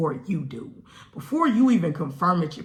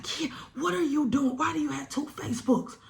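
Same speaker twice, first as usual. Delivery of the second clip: mom confronting; 10 semitones higher, 3 dB quieter than usual. Retold or imitated. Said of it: "she's like".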